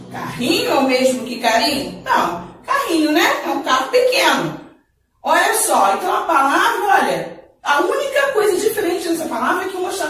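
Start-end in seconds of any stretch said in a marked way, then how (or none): none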